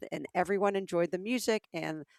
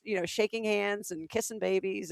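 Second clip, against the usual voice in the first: ordinary talk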